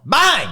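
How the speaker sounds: a sudden high shouted bang; mock crash noise. Telling friends about wrecking the car, a loud bang. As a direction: blurt out the crash sound.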